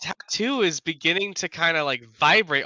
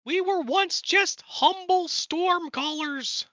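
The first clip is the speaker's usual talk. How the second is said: goblin voice; 13 semitones higher than usual. Acted. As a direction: speak as a grand goblin storm caller. Plays goblin Gobnarl telepathically.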